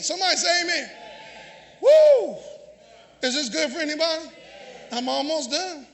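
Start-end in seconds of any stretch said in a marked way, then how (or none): 1.8-2.4 loud, high "woo"; exultant whoop. Real, not acted. Preacher after his declaration of faith, exultant whoop.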